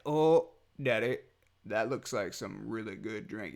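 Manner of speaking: lightly voiced awed toddler; impressed little kid. Voices toddler admiring drink.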